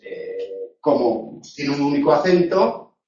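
normal talking voice

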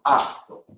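"acto" with k voiced like g, "agto". Shown as a demonstration. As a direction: say "acto" with a soft voiced g sound. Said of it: "Por ejemplo".

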